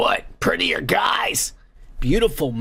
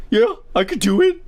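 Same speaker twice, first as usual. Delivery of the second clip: eager dope's higher-pitched reply; mock dumbass; 4 semitones higher than usual. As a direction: imitate a gullible dumbass agreeing. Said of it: "This dumbass is all like".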